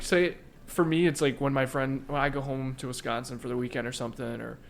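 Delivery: usual speaking voice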